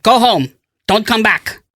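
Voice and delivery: mock firing. Chef firing the narrator.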